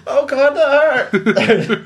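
high, drawn-out mock cry of pain; overacted pain reaction. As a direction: ham it up as a hurt film extra. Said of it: "doing, like, a reaction sound".

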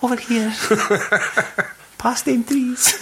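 high, put-on creature mimicry; high-pitched mock lure, tickled by it; mock lure call. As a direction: call them over in a silly high voice. Mimicking the Predator's lure, joking.

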